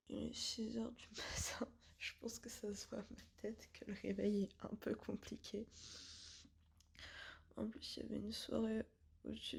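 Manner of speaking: groggy early-morning whisper. Real, not acted. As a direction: whisper so nobody wakes. Just woke at 6am.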